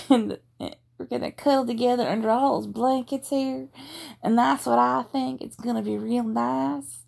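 put-on energetic Texas cowgirl accent with giggles breaking through; Texas Southern accent. Cowgirl teasing her city boyfriend at camp, giggly Texas accent. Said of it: "my horrible, offensive Texas".

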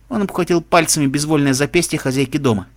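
ordinary delivery

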